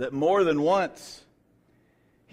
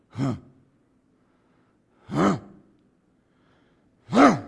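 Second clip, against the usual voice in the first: imitated lion huffs; 9 dB louder than usual. Imitating lion building to roar.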